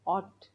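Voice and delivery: ऑट with an open aw; aught with aw. Shown as a demonstration. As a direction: say ऑट, not ओट. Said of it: "किंवा ओट पण म्हणतात". (ऑट, ओट नव्हे)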